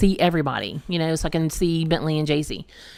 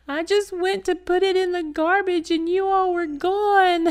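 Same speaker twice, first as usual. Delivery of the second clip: put-on much higher, small child's voice; upset little kid; 13 semitones higher than usual. Dad retelling his lost daughter's words, high kid voice. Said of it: "and Jason's like".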